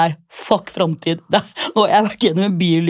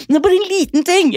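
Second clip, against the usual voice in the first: coaxing; 11 semitones higher than usual. Retold or imitated. Coaxing the Trondheim guy.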